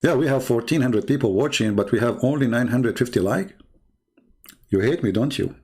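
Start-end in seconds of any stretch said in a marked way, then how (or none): none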